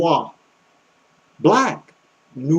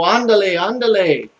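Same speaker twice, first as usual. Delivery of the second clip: imitated Mexican accent, pitched up; higher-pitched mock Mexican delivery; 4 semitones higher than usual. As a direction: mimic a lively Mexican Spanish speaker. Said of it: "they could really put the accent on it".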